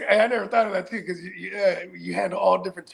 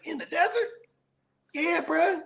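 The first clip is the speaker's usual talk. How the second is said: incredulous question; 7 semitones higher, 4 dB quieter than usual. Retold or imitated.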